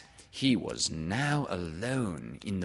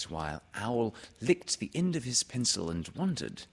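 plain reading voice

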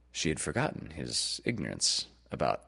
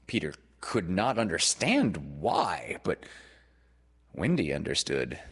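ordinary delivery, nothing marked

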